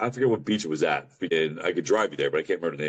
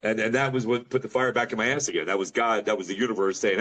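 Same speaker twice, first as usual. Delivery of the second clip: ordinary talk, nothing marked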